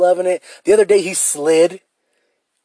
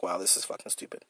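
much lower whisper; whispered self-scold; 10 semitones lower, 18 dB quieter, faster than usual. Retold or imitated. Quoting his own reaction to viewers, in a low whisper. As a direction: whisper it in disgust at yourself. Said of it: "I was like".

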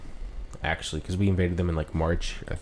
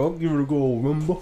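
high-pitched mock British bro; hammy Cockney rumble talk; British accent; 7 semitones higher than usual. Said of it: "it's a British bro".

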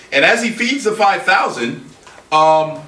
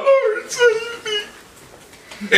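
high, slow cry for help; sinking man wailing to Jesus for rescue; much slower than usual. Acted. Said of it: "he cried out".

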